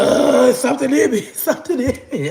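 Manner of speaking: put-on panic in a high voice; imitating possessed grandma. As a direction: wail like you're possessed, hammed up. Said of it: "with that like my grandma".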